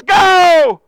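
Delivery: loud, high "Go!"; exclamation point shout. Acted. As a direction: fire it off like a command. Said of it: "with an exclamation point".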